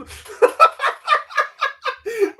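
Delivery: high burst of laughter; cracking up loudly; big laugh. Real, not acted. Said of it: "That's brilliant".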